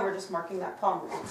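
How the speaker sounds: usual speaking voice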